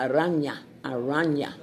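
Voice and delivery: "Araña" with a Spanish ñ, a-RAH-nya. Shown as a demonstration. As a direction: carefully sound out "Araña" in Spanish. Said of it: "This way you pronounce this word".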